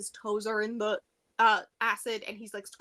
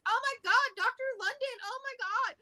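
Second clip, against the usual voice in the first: high-pitched imitation of shocked agents; hammed-up, high "oh my God" panic; mock alarm; 11 semitones higher than usual. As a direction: play rescuers exclaiming over a find, voice way up. FBI finding the captive doctor.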